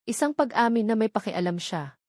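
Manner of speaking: usual speaking voice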